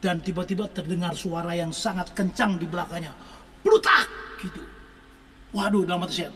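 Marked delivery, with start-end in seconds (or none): 3.6-4.1 sharp, high, loud cracking-bone noise; imitated crack. Preacher imitates the girl's spine cracking, sharp and loud. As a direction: snap out the sound of the bone.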